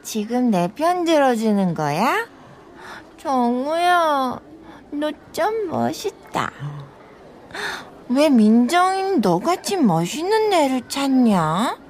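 tipsy flirting. Acted. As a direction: coo at him, woozy and smitten. Drunk, smitten with her defender.

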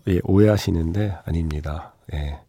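ordinary delivery

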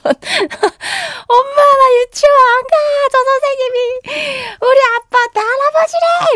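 put-on loud, high wailing child; crying child. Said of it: "이러면서 막 울면서". (엉엉 우는 아이 흉내, 높고 크게)

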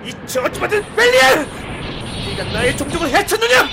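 high, screaming rage; furious shout. Acted. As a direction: roar it out in fury. Rage bursting out at the demon.

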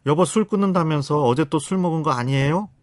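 acted reproachful interrogation; accusing spouse. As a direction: confront your spouse suspiciously. Spouse's accusing closed question, acted.

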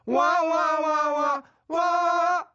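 high, loud chant of wa; mock crowd cheer.

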